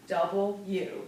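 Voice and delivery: "Double U" said crisply as two words; clear double u. Shown as a demonstration. Teacher repeats the letter clearly for students. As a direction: carefully articulate "Double U". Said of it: "This letter is two words".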